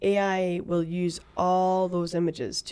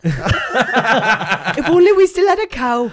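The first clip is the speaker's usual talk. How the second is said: laughing; 5 semitones higher, 11 dB louder, much slower than usual. Real, not acted. Joking, laughing.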